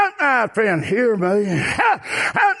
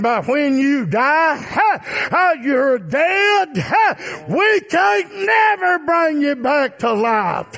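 high, sustained cry from the pulpit; preaching climax shout; 6 semitones higher than usual. Real, not acted. Preacher telling flock death can't be undone, climactic shout. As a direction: wail it out high in one long phrase.

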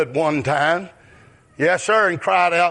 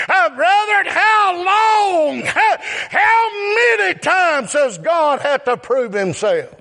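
high shouted preaching; preaching at full force; 10 semitones higher than usual. Acted. Preacher shouting to the brethren about God's proof. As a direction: call it out to the brethren.

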